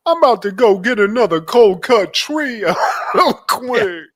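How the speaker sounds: high, loud voice, laughing at the end; mocking Darnell's exit; Darnell quip. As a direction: toss off the exit line. Voicing Darnell leaving.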